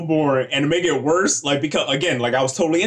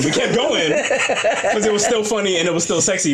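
ordinary talk

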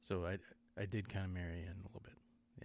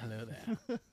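put-on Scottish accent; slower than usual. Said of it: "his regular accent to play Obi-Wan".

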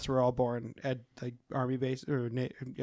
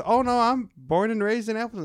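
put-on higher, singsong Wisconsin accent; Willem Dafoe; 7 semitones higher, 4 dB louder than usual. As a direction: play up a Midwestern accent. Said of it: "he did a Wisconsin".

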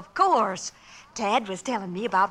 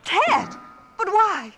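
alarmed; 8 semitones higher, 4 dB louder, much slower than usual. Acted. Frightened mother hears son targeted.

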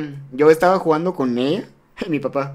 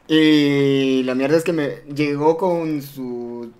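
normal talking voice

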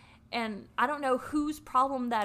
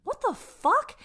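high, louder burst of disbelief; shocked outburst; 12 semitones higher, 6 dB louder, slower than usual. Retold or imitated. Woman reading his secret texts, retold shocked outburst. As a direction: jump up in pitch, appalled.

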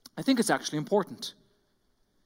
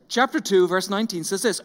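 ordinary talk

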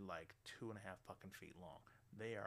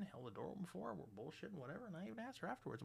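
normal talking voice

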